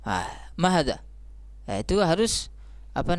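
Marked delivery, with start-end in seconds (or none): 0.5-1.0 "mahadza" run together with a falling end; wrong statement intonation. Shown as a demonstration. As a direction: say "mahadza" as if only informing. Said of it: "tapi kita bacanya kalimatnya khabariyah". (intonasi berita yang keliru, "mahadza" turun)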